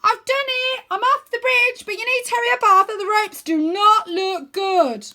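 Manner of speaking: high, loud triumphant shout; excited cartoon shout; Wyn shouting. A narrator's reading. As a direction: yell down from the top. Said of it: "Wyn shouts".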